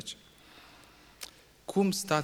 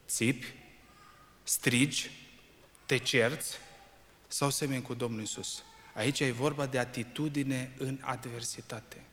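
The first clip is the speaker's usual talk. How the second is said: usual speaking voice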